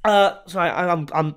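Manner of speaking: hiccuping mid-sentence; talking through hiccups. Real, not acted. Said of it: "I'm hiccuping again".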